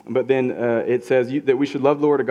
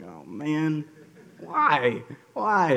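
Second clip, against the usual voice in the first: whiny complaint; 2 semitones higher, much slower than usual. Retold or imitated. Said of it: "that'd probably be even more like".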